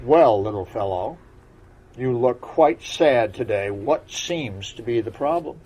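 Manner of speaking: put-on kindly old frog asking what's wrong; old frog asking the sad unicorn his problem; froggish voice. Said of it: "in a deep frogish voice".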